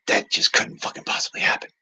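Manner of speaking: whispered movie-theater comment. Shown as a demonstration. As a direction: say it under your breath, voiceless. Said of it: "Like that".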